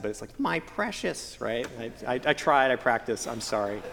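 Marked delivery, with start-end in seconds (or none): 0.4-1.4 a hint of Gollum, slow and hushed; half-hearted try at the creepy Gollum voice. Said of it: "I can't say it with that accent".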